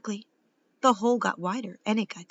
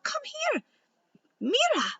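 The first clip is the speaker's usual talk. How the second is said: very high, urgent beckoning; calling out. A narrator's reading. Girl wants her brother at the crack, calling out. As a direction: call someone over in a high voice. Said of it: "she called".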